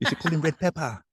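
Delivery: high put-on London voice ending on isn't it; local London accent. Locals over here naming him, in a high London accent. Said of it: "That's how they talk".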